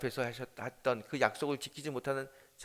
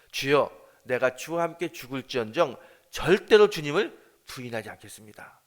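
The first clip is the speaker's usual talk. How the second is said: bold vow; 11 dB louder than usual. Acted. Peter vows before Jesus.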